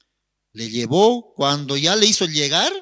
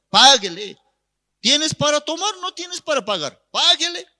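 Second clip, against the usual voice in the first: loud, high-pitched acted order; indignant policeman laying down the law; scolding officer; 12 semitones higher, 6 dB louder than usual. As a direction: scold him and demand payment. Policeman orders drunk man to pay.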